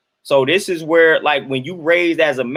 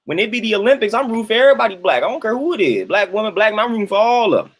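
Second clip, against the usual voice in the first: higher, fired-up rooting; excited cheering; 6 semitones higher than usual. Real, not acted. Cheering on Olympic athletes for the stream, real excitement. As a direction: hype up the whole crew.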